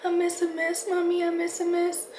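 put-on high little-kid voice, lisping; toddler voice. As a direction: imitate a toddler saying it sweetly. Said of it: "the way she says it".

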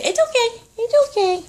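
high soothing; reassuring pet talk. Real, not acted.

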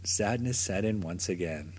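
plain reading voice

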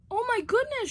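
high, loud alarmed cry; shocked shout. Acted. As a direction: cry out at the crash. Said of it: "shouted Afton".

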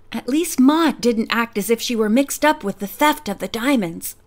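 ordinary delivery, nothing marked

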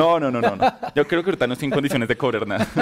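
amused, laughing refusal; laughing protest. Real, not acted. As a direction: protest through real laughter. Bromean sobre cobrar apuestas.

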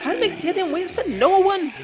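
high voiced scolding old dog; old terrier voice. A narrator's reading. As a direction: lecture the youngsters from above. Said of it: "said the terrier".

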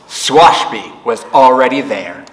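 usual speaking voice

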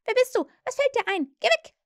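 very high, loud crowing squawk; fairy woken up, crowing angrily. A narrator's reading. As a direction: screech him away from her bed. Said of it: "krähte sie".

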